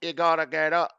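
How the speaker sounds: loud, high fake Scottish accent; bad Scottish Siri impression. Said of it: "That's not even close to Scottish".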